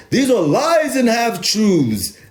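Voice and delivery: high, slow put-on English voice; English accent. Shown as a demonstration. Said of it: "If I was to speak in my English voice".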